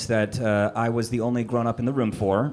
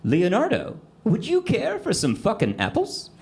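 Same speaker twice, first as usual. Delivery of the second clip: put-on bright higher little-girl voice, polite and sweet; little daughter; 8 semitones higher than usual. Daughter politely offering her brother apples, sweet little-girl voice. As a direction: play the sweet four-year-old offering apples. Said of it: "and says this".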